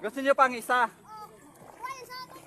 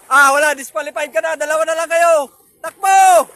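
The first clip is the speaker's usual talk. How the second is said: loud referee calls; calling out loud; 14 dB louder than usual. Real, not acted. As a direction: shout the calls across the yard.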